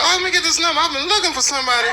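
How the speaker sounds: high, fast eager-customer voice; mock eager customer. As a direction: play a clueless customer who is thrilled to find the number. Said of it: "who's gonna pull up like".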